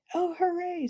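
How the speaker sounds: high, bright cry of joy; delighted cheer. Acted. Boy thrilled to head north, excited cheer. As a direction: burst out with boyish glee.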